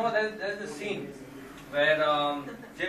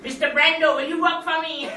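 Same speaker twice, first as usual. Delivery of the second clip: high, loud villain plea; Skeletor voice; 9 semitones higher, 10 dB louder than usual. Acted. Villain begs the Godfather to join him. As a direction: ask it in a shrieking cartoon villain voice.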